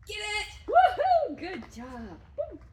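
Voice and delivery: high, loud whooping cheer; gleeful woohoo; excited cheer. Shown as a demonstration. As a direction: whoop for the dog. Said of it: "make him aware that this is fun".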